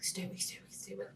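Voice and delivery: hushed, urgent repeat to herself; whispered self-urging. Retold or imitated. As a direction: whisper it to stay awake. Telling herself to stay awake.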